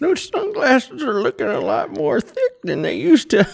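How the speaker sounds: put-on high, slow creaky old man. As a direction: tease like a grumpy grandpa. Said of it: "He's trying to make an old man voice".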